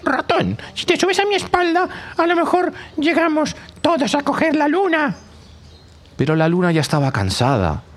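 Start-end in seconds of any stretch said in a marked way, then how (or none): 0.0-5.1 very high, chattering monkey voice; squeaky monkey, laughing along. Acted. Monkey invites the mouse onto its back, very high.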